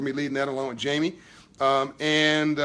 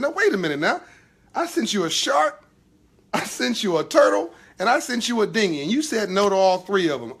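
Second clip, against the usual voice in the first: a higher, patient God gently setting him straight; 5 semitones higher, 4 dB louder than usual. Acted. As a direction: lay out the three rescues like a patient father. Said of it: "God, who's very patient".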